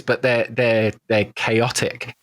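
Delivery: ordinary talk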